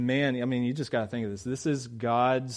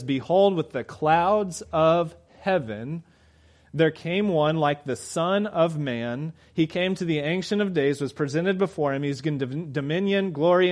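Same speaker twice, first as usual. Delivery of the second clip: solemn scripture reading; 3 semitones higher, 3 dB louder than usual. Acted. Preacher reading Daniel to congregation, solemn and grand. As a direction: intone the vision with weight.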